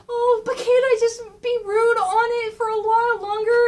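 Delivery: put-on high, loud pleading kid voice; whiny kid. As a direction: beg like a child who won't get down. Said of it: "just like a kid".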